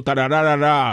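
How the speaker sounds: "tarararara" run together evenly; rushed, unaccented run. Shown as a demonstration. Pianist showing how not to play it, "tarararara" as one even run. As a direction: rattle off "tarararara" with no break before the end. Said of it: "Yo no digo".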